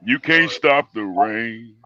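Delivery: slow, low and heavy; slow deep voice. Real, not acted. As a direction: drag it out in a low voice. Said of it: "My man got that bass".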